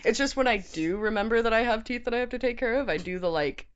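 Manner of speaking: talking round a sour candy ball; mouth full. Real, not acted. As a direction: speak past a mouthful of candy. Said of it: "we definitely can't talk with these big old things in our mouths".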